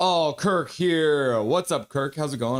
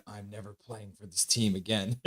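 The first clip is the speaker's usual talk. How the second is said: much softer, hushed and laughing; whispered; 6 semitones lower, 7 dB quieter than usual. Retold or imitated. Goalie quoted whispering to his coach after a blowout, hushed. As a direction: murmur it low like a secret through a laugh. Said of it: "and whispered".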